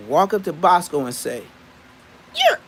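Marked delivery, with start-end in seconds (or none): 2.3-2.6 high-pitched mocking call; say it just like that. Shown as a demonstration. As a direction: squeal it high, then let it drop. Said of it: "Say it just like that".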